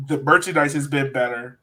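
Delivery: ordinary talk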